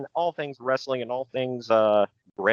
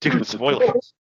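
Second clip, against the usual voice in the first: high-pitched catchphrase; 5 dB louder, slower than usual. Retold or imitated. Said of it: "that's his new catchphrase".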